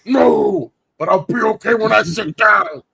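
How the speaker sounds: high, loud pained yell; pained shout. Retold or imitated. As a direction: shout it through the pain. Acted pained reply.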